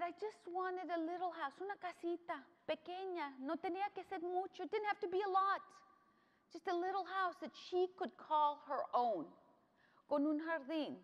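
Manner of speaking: high, soft pleading as a grandmother; retold tearful wish. Grandma wishing for a small house, retold in high, soft sorrow. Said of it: "And she was crying".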